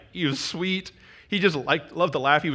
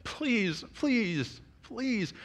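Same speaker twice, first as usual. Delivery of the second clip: soft pleas climbing higher; pleading child; 3 semitones higher, 4 dB quieter than usual. Retold or imitated. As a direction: imitate a child begging, stretching out the last pleases. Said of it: "If you could hear the pleas in his voice".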